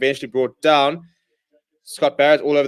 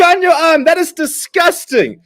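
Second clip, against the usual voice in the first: excited shout; 5 dB louder, slower than usual. Real, not acted. Fan reacting to a brilliant offload.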